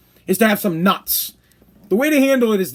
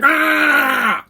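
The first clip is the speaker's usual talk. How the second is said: fired-up yell; 7 semitones higher than usual. Retold or imitated. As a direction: let out a hype yell like the anthem just ended. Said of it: "I'd be like".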